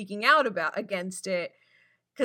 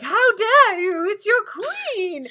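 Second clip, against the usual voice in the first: higher, louder, slow indignant queen; a hammed-up royal "how dare you"; mock outrage; 10 semitones higher, 6 dB louder, much slower than usual. Regina scolding the market crowd, hammed-up outrage. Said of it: "she's just like".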